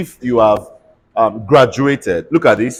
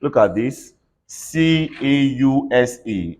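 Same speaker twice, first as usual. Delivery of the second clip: ordinary delivery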